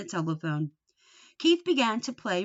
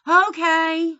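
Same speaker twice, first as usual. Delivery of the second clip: calling back; 9 semitones higher, 11 dB louder than usual. A narrator's reading. Boy answers mother's call.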